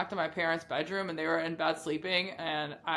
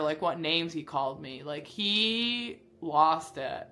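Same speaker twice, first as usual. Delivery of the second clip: usual speaking voice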